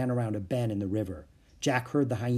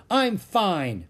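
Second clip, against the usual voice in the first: loud, raised insistence; indignant shout; 7 semitones higher, 6 dB louder than usual. A narrator's reading. Annie defying the vulture, indignant shout. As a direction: shout it in defiance. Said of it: "Annie shouted at the vulture".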